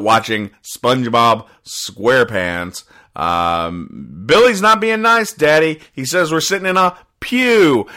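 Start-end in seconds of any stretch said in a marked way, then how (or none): 4.3-7.9 put-on high, louder tattling child; a child complaining to Daddy in church, high and whiny; whiny kid voice.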